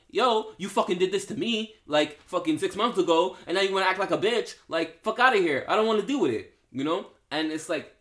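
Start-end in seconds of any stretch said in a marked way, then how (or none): 0.1-6.5 high, loud, heated rant; angry boyfriend rant. Retold or imitated. Boyfriend yelling at his girl during a fight, angry and loud. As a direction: fire it back like a man who refuses to talk it out. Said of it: "Or they're like".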